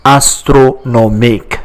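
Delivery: astronomique spoken syllable by syllable, final -ik; French pronunciation. Shown as a demonstration. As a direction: model astronomique in French for a learner. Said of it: "Remember the pronunciation".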